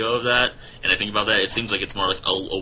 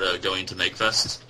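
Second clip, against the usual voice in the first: normal talking voice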